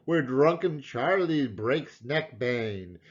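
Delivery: usual speaking voice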